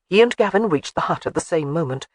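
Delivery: ordinary delivery, nothing marked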